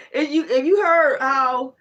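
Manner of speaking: laughing till it hurts. Real, not acted. Laughing while retelling.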